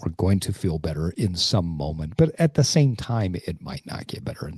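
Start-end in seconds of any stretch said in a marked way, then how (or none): 3.5-4.6 a soft whispered aside, much softer; softly whispered admission. Real, not acted. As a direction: let it drop to a whisper.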